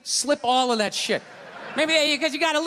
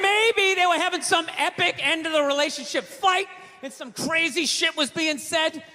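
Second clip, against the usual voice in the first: ordinary talk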